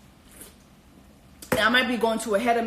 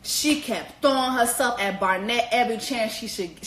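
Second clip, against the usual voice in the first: ordinary delivery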